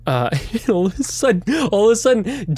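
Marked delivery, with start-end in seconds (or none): none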